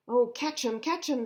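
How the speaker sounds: high, loud urgent catch him; excited cry. Acted. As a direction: shout after the runaway monkey. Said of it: "they cried".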